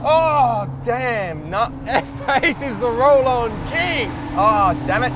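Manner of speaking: high, loud dismay, laughing; dismayed exclamation. Real, not acted. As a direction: groan out the loss. Losing the race.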